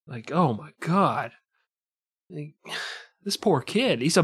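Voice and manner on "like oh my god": usual speaking voice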